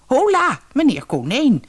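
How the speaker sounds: put-on high, cheeky old lady voice; a crafty old dame playing innocent; sly old weasel. A narrator's reading. Old weasel greets the rabbit in her house, high and cheeky.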